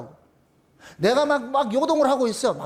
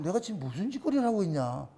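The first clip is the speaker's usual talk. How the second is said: low, hushed voice to oneself; muttered self-questioning; 6 semitones lower, 8 dB quieter than usual. Acted. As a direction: act out a quiet moment of self-realisation. Acted inner voice coming to one's senses. (낮고 작게 혼잣말하듯)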